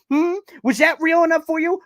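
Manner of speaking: taunting. Real, not acted. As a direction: rub it in with a rising "real". Taunting Bills fans, repeated.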